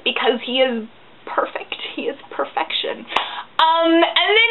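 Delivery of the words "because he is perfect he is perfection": whispered adoration; soft, worshipful whisper; reverent whisper. Real, not acted. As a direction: breathe it in hushed awe. Gushing over her favourite character.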